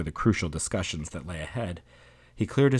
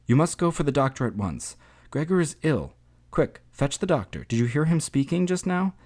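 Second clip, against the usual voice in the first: high-pitched, urgent mother's voice; urgent pleading; 7 semitones higher than usual. A narrator's reading. Mother sends Greta for the doctor, urgent and high. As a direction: send for help in a raised, pressing tone.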